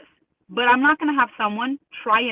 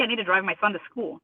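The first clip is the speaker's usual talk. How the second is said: normal talking voice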